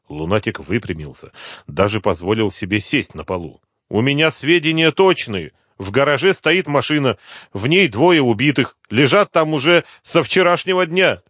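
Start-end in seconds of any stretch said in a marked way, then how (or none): 3.9-11.2 higher-pitched voice of a pleading snitch; anxious addict informant. Acted. Addict tells the inspector about hidden bodies, high insistent voice. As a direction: play the informant eager to be believed.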